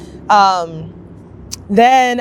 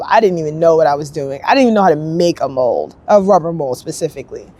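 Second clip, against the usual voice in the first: usual speaking voice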